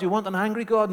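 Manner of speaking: incredulous question. Acted. Preacher challenging the congregation, mock disbelief. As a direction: throw it back at them in disbelief. Turning the objection back on them.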